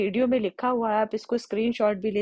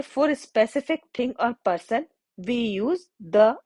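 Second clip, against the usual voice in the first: slow reading of the rule; much slower than usual. Shown as a demonstration. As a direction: read the rule very slowly, word by word. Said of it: "अब आप देखिए". (नियम को बहुत धीमे, शब्द-शब्द पढ़ना)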